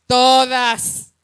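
todas dicho fuerte y alargado, casi gritado; remarcando todas. Acted. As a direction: clava la palabra todas con peso. Repite todas con fuerza. (todas, dicho con fuerza)

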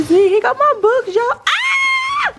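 an overjoyed high yelp; thrilled squeal. Real, not acted. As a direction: squeal with real surprise. Thrilled her books arrived.

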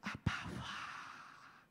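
slow, breathy whisper to the Father; whispered prayer. Shown as a demonstration. Pastor shows the weary believer, whispering. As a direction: whisper it slowly, worn out. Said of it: "很累的时候".

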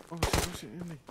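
panicked. Real, not acted. Sudden fright under fire.

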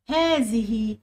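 "هذه" with a long aa after the h; sounded alif. Shown as a demonstration. Teacher checking spelling with students, "هذه" with its alif drawn out. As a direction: draw out the hidden alif in "هذه". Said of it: "الألف بتاعتها المفروض تكون بتنطق".

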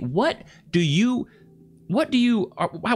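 ordinary talk

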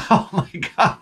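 high laughing relief; overjoyed laugh. Retold or imitated. Driver sees the car passed inspection, laughing in relief.

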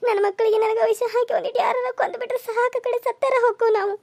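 high-pitched weeping wail; sobbing through a high, anguished complaint; tearful lament. Acted. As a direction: cry out to God in despair. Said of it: "ಅಳಬೇಡ ನೀ".

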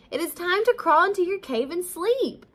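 high, loud and quick urging; urgent. A narrator's reading. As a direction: rush it out in a high, insistent voice. Ladybug urgently orders Bear to sleep.

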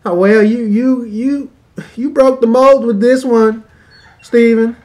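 high, loud stammering praise; mock adoring wife. Wife praises Steven, starstruck and stammering. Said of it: "she comes to taste".

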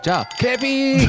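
high, quick cheer as if toasting; demonstrated toast. Shown as a demonstration. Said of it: "how would you use that in a sentence".

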